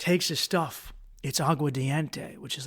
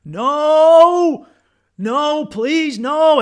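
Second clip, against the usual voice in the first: high, loud begging cry of another man; desperate pleading; 12 semitones higher, 11 dB louder, much slower than usual. Retold or imitated. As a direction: beg in terror, much higher and louder. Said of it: "then I hear this guy".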